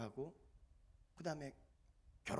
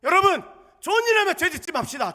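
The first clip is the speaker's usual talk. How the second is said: loud exhortation; 20 dB louder than usual. Real, not acted. Preacher's opening exhortation.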